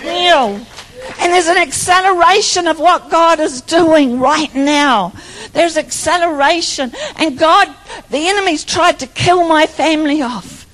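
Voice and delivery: rising sermon fervour; fervent preaching. Acted. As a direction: preach it out over the congregation, building. Preaching at full force.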